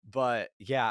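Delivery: ordinary delivery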